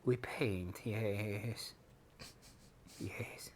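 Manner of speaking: low, quiet goblin muttering. Pretend. Goblin painting his own Warhammer miniatures, low and quiet. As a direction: softly croon like a pleased goblin. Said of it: "one of your goblin stevs is painting".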